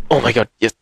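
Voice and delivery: ordinary talk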